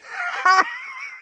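triumphant laughing shriek; high-pitched gleeful squeal. A narrator's reading. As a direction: let out a very high squeal of glee. Ron's triumph on hearing of kiss.

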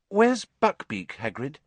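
slow, high-pitched tentative question; hesitant. A narrator's reading. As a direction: ask slowly and carefully. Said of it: "said Hermione hesitantly".

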